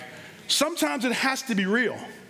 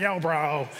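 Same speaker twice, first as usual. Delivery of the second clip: annoying voice; 8 semitones lower than usual. Retold or imitated. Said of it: "it's like that annoying voice".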